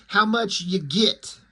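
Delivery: "get" said as "git"; dialect "get". Retold or imitated. Crook asking the girl about money, "get" pronounced "git". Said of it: "G I T".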